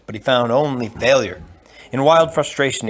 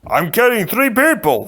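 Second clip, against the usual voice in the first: higher, louder gentle giant; a big polite man, puzzled; 10 semitones higher, 4 dB louder than usual. Acted. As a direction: giant complaining to the Sicilian on his neck. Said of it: "Fezzik explained".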